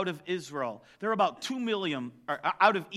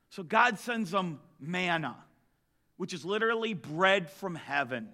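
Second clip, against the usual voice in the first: normal talking voice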